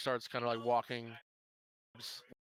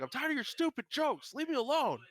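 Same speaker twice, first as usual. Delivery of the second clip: much higher and louder, furious and done; fed-up yell; 5 dB louder than usual. Acted. Server yelling at the pranking cook, high furious yell. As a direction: yell at him, totally fed up. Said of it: "and she's like".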